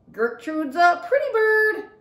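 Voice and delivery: high, loud, slow parrot squawk; screaming parrot. A narrator's reading. Parrot Gertrude praising herself to the visitors. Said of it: "screamed Gertrude".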